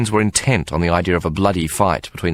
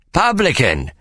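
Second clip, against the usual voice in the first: high called summons across the crowd; calling out; 9 semitones higher, slower than usual. Acted. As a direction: call him over the heads. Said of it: "he called".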